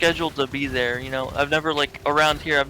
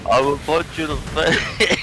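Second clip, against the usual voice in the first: Balboa tough guy; 4 dB louder than usual. Retold or imitated. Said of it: "I was doing a Rocky Balboa thing".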